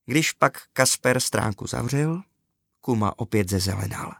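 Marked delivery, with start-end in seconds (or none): none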